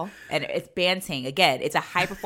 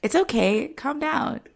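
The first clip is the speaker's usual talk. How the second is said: mock soothing; 5 semitones higher, 9 dB louder, slower than usual. Mock soothing a demented grandma.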